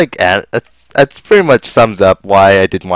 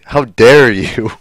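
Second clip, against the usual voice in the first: mock offended; 3 semitones higher, 4 dB louder, much slower than usual.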